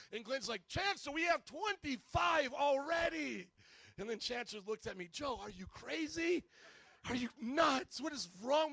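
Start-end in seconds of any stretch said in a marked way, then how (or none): 0.7-3.5 high, loud, drawn-out complaint; slow, acted-out shout to the boss; shouted protest. Glenn protests in retold argument. 5.1-8.7 high, loud disbelief; acted-out angry boss; exasperated scolding. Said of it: "He was all mad at us".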